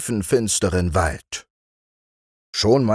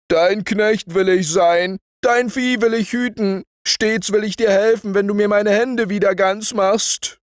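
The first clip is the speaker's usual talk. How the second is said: high, strained begging voice of a maimed giant; pleading giant; 11 semitones higher than usual. A narrator's reading. Giant begs the shepherd at his feet, high pleading voice. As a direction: giant grovelling for his hands back, pitched way up. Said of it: "fiel dem Hirten zu Füßen und flehte".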